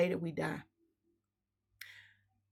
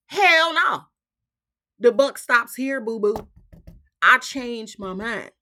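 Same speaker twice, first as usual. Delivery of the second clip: defiant refusal; 2 semitones higher, 8 dB louder than usual. Real, not acted. Refusing the system.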